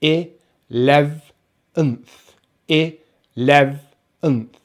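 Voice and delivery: eleventh broken into "i, lev, unth"; syllable by syllable. Shown as a demonstration. Teacher breaking eleventh into parts for learners, syllable by syllable. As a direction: sound out "i, lev, unth" with gaps. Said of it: "So we have".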